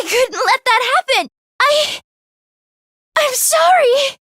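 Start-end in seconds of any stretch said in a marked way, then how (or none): 1.6-4.2 anguished sorry. Acted. Pained apology shout.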